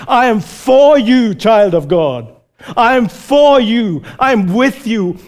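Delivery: fervent reassuring vow; God's warm promise. Retold or imitated. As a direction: speak as a loving father vowing protection. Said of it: "his posture is like this".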